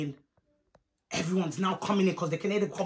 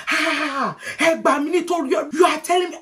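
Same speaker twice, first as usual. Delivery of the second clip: high, loud mock indignation; affronted auntie; 5 semitones higher, 4 dB louder than usual. Auntie offended at the girl's insult, high loud indignation. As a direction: gasp out offended disbelief, pitched up.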